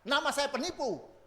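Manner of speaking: slow, high, ringing declaration; loud proclamation. Retold or imitated. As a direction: say it out proudly and slowly. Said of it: "dengan suara nyaring, ya, suara keras dia berkata".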